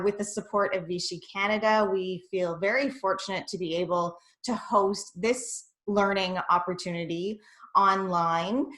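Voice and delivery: ordinary talk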